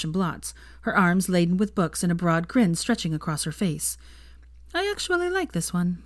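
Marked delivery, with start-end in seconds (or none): 4.7-6.0 a hint of Hermione smiling at the photo; lightly voiced Hermione.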